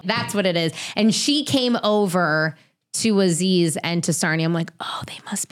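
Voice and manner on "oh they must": hushed whispered aside; whispered inner realization. Retold or imitated. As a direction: breathe it like a secret thought. Private thought voiced as whisper.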